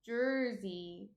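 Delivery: "Jersey" with the R fully sounded; "Jersey" corrected, clear er vowel; correct pronunciation. Shown as a demonstration. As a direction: say "Jersey" slowly and clearly as jur-zee. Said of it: "They all say Jersey".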